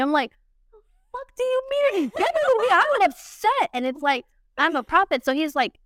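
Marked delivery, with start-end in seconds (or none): 0.7-3.7 much higher, indignant retelling of her outburst. Retold or imitated. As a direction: fire it back in disbelief and outrage. Retold outburst at God.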